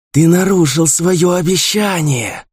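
high, slow hiss stressing нарушил; hissed through bared teeth. A narrator's reading. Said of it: "прошипела она сквозь оскаленные белые зубы". (шипение сквозь оскаленные зубы)

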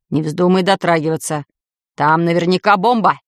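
usual speaking voice

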